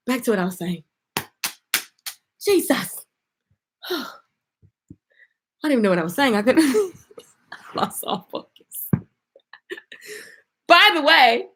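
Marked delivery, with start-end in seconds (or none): none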